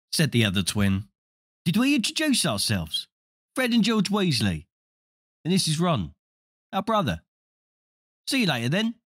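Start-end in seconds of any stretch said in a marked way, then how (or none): 1.6-9.0 put-on young boy voice. Twin introduces family.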